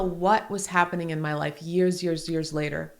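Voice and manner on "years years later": usual speaking voice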